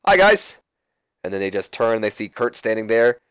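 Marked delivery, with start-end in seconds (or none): 0.1-0.6 much higher, louder chirpy greeting; cheery goofy hello. Retold or imitated.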